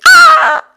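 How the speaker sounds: sudden very high, very loud shriek; startled laughing scream; mock scream. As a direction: yelp like dropping your favorite crystal. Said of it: "Sorry, that was, like, so loud".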